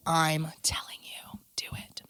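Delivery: slow voiceless whisper. Real, not acted. Urging listeners to try it, whispering. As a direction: lean in and whisper the tip.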